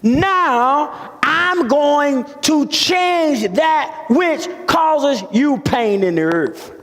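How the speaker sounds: slow, high, drawn-out proclamation; measured pulpit crescendo easing at the end; preached divine promise. Acted. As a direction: declare it word by word as Jesus' promise. Preacher voices Jesus' promise emphatically.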